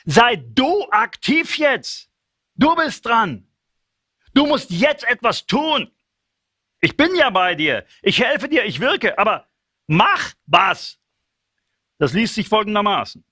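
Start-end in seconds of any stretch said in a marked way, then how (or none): 0.0-11.0 higher, pushing God's voice, rising to a final high "mach was"; urgent prodding. Acted. God urges Moses to act, prodding and rising. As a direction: nudge him hard to get moving. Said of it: "Er sagt".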